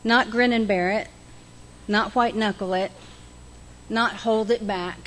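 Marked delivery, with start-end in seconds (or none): none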